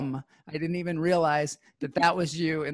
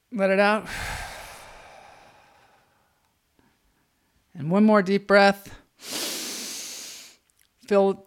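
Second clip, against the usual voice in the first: calm grounding exercise; 2 semitones higher, 5 dB louder than usual. Acted. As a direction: talk them through each breath gently. Leads breathing exercise.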